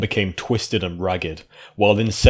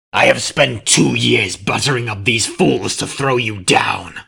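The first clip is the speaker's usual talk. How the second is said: louder, snarling creature voice; angry shout; 3 semitones higher, 5 dB louder than usual. A narrator's reading. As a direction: hurl it at the princess. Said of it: "the changeling shouted in anger".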